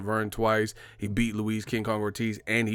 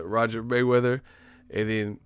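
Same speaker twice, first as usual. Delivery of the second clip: ordinary talk, nothing marked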